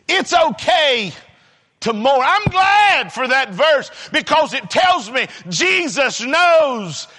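high, emphatic pulpit delivery; full-force preaching. Real, not acted. Preacher defending the need to cry, impassioned preaching. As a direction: drive each phrase out to the congregation.

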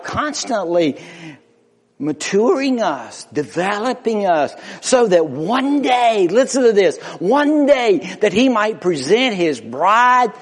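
high, driving pulpit delivery; impassioned preaching. Real, not acted. Preacher telling the church of Christ and his bride, impassioned. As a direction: build in force, urging the congregation.

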